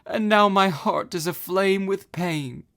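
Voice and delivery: high, slow tearful lament; self-pitying wail. Acted. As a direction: moan it out, sorry for herself. Ozma laments.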